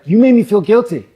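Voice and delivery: high, loud mock-wounded whine; hammed-up guilty complaint to the girl; mock accusation. Retelling accusing a child.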